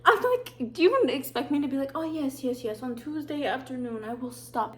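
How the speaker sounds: mocking retort. Mocking the leave-of-absence staff.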